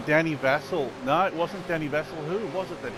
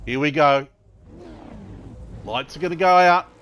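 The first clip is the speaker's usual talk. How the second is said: loud, rising race start call; race caller; 5 dB louder than usual. Real, not acted. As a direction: build the hype as lights go out.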